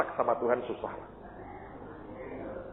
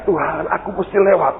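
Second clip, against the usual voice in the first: loud, raised cry to God; retold shout; 7 semitones higher, 8 dB louder than usual. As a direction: act out a man shouting up to God. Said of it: "Dia teriak".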